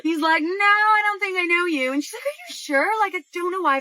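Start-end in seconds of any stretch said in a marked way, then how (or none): 0.4-1.9 high, friendly imitation of a polite stranger; friendly denial. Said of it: "And he's pretty friendly". 2.2-3.8 high-pitched, doubtful questioning; doubtful insistence. Retold or imitated. Woman doubts the stranger's denial.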